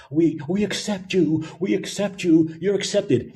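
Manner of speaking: mock reassurance. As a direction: placate them in a lightly mocking high voice. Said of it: "constantly have that exception".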